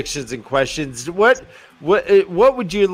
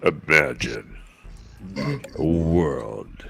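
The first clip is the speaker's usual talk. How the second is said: much lower, epic trailer narrator; deep booming "in a world" announcer; movie trailer voice; 13 semitones lower, 7 dB quieter, much slower than usual. Pretend. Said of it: "That voice".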